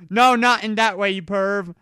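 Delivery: high, loud scolding; mock outraged yell; loud protest. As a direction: shout at the perv. Rebuking a pervy move.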